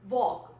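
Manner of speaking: Walk said as wok, no l; teacher modelling Walk; silent l. Shown as a demonstration. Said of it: "How do I pronounce walk?".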